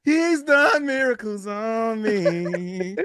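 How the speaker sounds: laughing through tears, voice cracking high; real choked-up laugh, slow and high on the second line; emotional laughing. Real, not acted. Fan overcome by his quarterback's miracle, laughing and tearing up. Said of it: "I'm getting emotional".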